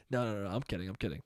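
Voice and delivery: ordinary talk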